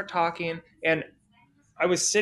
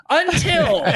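high, loud storyteller's cliffhanger; dramatic turn; 10 semitones higher, 8 dB louder than usual. Real, not acted. Storyteller halting his lucky streak, dramatic turn. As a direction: belt out the twist, pitched way up.

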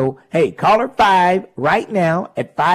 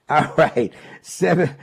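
ordinary delivery

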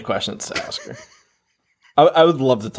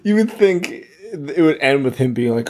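ordinary delivery

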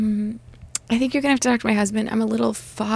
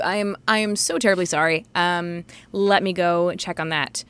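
normal talking voice

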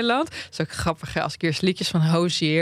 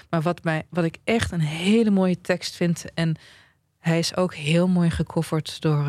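normal talking voice

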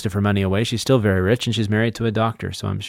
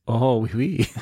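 playful put-on French accent; slower than usual. Co-host accepting the French accent challenge, mock French. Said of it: "In a French accent".

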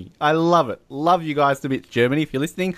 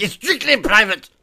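higher, strict mock voice; stern woman imitated; 7 semitones higher than usual. Contrasting her nice version, mock stern and high. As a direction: mimic a forbidding woman's warning. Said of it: "She's like".

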